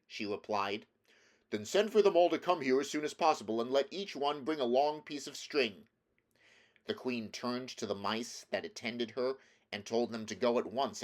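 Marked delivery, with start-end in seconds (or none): 1.5-5.9 lightly voiced Scarecrow, quick and louder; brisk Scarecrow. A narrator's reading. Scarecrow tells the queen to summon her mice, brisk raised voice. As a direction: fire off instructions briskly.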